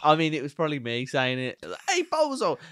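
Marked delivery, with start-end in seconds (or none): 1.9-2.6 high New York street call; hammed-up New York shout; mock New York accent. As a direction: heckle like a cartoon New Yorker. Imitates New Yorker saying bozo.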